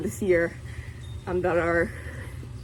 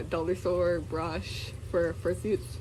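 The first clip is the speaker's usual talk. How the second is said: ordinary delivery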